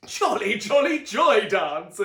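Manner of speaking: loud, slow chanted shout; the family shouts together, loud chant; group shout. A narrator's reading. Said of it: "everyone but Luna shouted".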